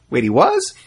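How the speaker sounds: put-on high-pitched, questioning child's disbelief; mock childlike astonishment; surprised kid. Child learns a surprising fact.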